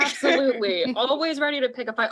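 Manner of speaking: put-on high, quick Southern accent. Voice actor slipping into her other Southern character's accent. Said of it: "Oh, wait, that's my other one".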